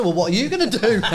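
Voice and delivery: cheeky challenge. Retold or imitated. Physio teasing the coach over sandwiches, cheeky challenge. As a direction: throw the challenge back, amused. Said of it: "So I thought".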